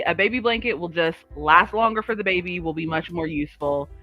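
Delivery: ordinary delivery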